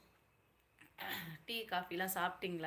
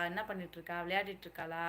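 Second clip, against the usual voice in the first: ordinary delivery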